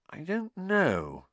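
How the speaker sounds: slow, high, hesitant reply; slowly. A narrator's reading. Girl unsure about the mysterious gift, answering slowly. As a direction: stretch it out doubtfully, pitched high. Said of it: "said Hermione slowly".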